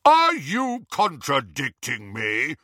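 slow, imperious challenge; blustering. A narrator's reading. Harry cuts off the doubting wizard, blustering. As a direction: draw each word out indignantly. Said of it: "Harry blustered".

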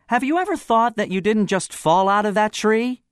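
high, loud accusing questioner; voice from platform. Acted. Anonymous questioner makes an accusation.

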